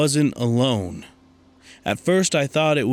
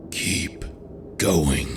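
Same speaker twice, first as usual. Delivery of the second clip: eerie whisper; 6 semitones lower than usual. Acted. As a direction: breathe it close to the ear. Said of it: "The voice came again, closer now".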